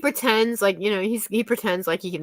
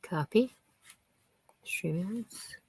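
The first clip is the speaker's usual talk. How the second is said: whisper; 2 semitones lower, 10 dB quieter, much slower than usual. Real, not acted. Muttering to herself off-mic.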